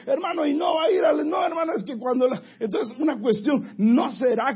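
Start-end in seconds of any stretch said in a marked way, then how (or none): 0.1-1.3 higher, near-crying plea; pleading brother. Acted. Brother pleading with brother to attend the convention, near tears. Acted exchange between two believers. 1.3-2.4 hurried excuse. Acted. Acted exchange between two believers.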